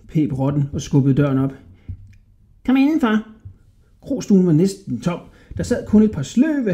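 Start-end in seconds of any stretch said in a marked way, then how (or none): 2.7-3.3 high, squeaky, wheedling rat voice; sly little rat man. A narrator's reading. Little rat flattering a hungry Hodja, high and squeaky.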